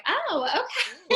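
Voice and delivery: high slow laughing approval; amused, impressed oh okay; pleasantly surprised. Retold or imitated. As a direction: admire yourself in the mirror with a laugh. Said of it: "and you're like".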